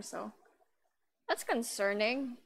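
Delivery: ordinary talk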